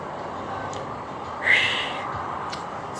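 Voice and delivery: long shaky breath out; heavy sigh. Real, not acted. Ending a grief video, a long heavy sigh. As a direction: let out a tired sigh. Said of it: "before I start crying".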